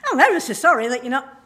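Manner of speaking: a jaunty sorry that misses the mood; sing-song ever so sorry, all wrong for sympathy; wrongly chirpy sorry. Shown as a demonstration. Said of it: "You can't say".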